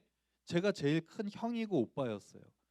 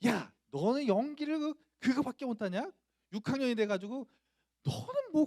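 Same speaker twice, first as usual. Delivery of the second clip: high-pitched angry scolding; imitating a harsh teacher's tirade; insulting, berating teacher; 9 semitones higher, 4 dB louder than usual. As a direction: tear into the pupil with contempt. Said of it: "모욕적인 말을 막 하시는 거예요".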